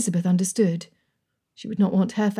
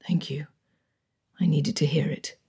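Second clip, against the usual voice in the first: moved whisper; 4 semitones lower than usual. A narrator's reading. Darcy thanking his cousin for his promise, in a hushed whisper. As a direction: breathe the thanks out almost silently.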